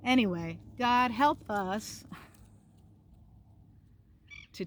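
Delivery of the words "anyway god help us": plain reading voice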